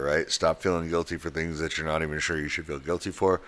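ordinary talk

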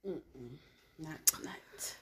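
a breathy whispered no. Real, not acted. Turning down the host in a whisper. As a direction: whisper it, barely voiced.

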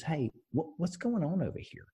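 a hint of a frail old woman asking; old lady at the window asking what's going on; grandma style. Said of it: "she's like a grandma style".

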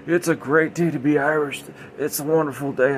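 put-on sloppy, merry drunk Irishman voice; drunk Irish dad. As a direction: play the cheery drunk Irish father. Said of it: "his dad apparently is drunk".

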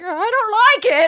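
high, loud, slow scared whine; high, slow and loud, a stoned kid begging for control; panicked whine. Retold or imitated. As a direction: plead in a freaked-out high voice. Repeated panic line.

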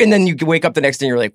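normal talking voice

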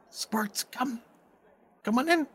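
high, soft summons; beckoning humanoid man. Acted. Man at door beckons, high and soft. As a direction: call someone over discreetly. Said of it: "a young-looking humanoid man says".

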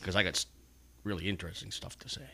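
quiet, dry sarcasm; sarcastic. Real, not acted. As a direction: mutter it with self-mocking sarcasm. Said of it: "That was sarcasm".

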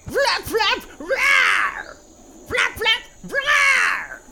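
high shouted bird-like chant; pterodactyl screech. Acted. Pterodactyls voiced.

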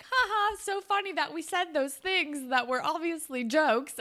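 high-pitched fake jollity; mock amusement. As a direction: spell out the joke with put-on cheer. Acting friends laughing off their joke.

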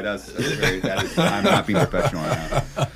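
talking through held-back laughter; trying hard not to crack up; stifled giggles. Real, not acted. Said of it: "I'm trying not to laugh".

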